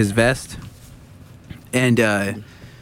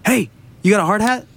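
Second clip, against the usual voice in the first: high-pitched hail, then question; imitated high, insistent call; retold call-out; 10 semitones higher than usual. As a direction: call over to a worker. Retold calling out to worker.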